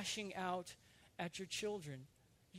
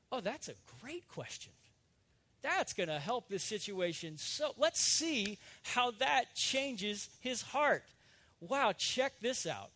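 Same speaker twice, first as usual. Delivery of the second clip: mocking, drawn-out sarcasm, slower and louder as it goes; mock sarcastic inner voice; 4 semitones higher, 6 dB louder, slower than usual. Preacher voicing his own sarcasm mocking himself, mock sarcastic. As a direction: sneer at yourself like a snide inner critic. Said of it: "my rhetorical sarcasm turns on me".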